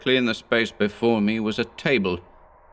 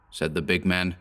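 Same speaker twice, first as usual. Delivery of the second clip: plain reading voice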